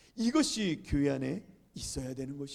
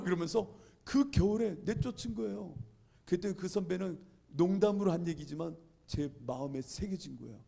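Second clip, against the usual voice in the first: ordinary delivery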